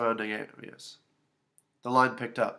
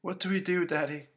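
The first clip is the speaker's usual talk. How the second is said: small, high, softer voice; anxious little girl; worried child; 6 semitones higher, 4 dB quieter than usual. A narrator's reading. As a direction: ask Daddy for help, timidly. Scared child asks father.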